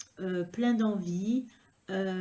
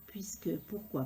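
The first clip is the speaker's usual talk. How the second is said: whispered question; 3 semitones lower, 8 dB quieter than usual. Real, not acted. Hushed rhetorical question.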